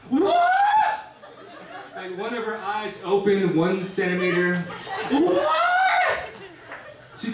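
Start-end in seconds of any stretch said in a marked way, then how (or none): 0.1-0.5 shrill mock shriek of outrage, much higher; shocked shriek. Mother shocked at daughter news. 5.1-6.3 shrill mock shriek of outrage, much higher; shocked shriek. Mother shocked again.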